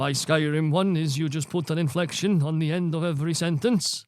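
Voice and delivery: put-on Scandinavian lilt; Skyrim-style Scandinavian voice; generic Scandinavian accent. Shown as a demonstration. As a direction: inflect the sentence ends in a Scandinavian accent. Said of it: "Do your Skyrim".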